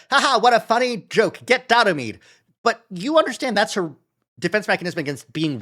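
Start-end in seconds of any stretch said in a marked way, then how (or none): 0.1-2.2 higher, louder mocking voice; mock fake laugh. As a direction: imitate her fake cheerful laugh. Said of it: "she did the".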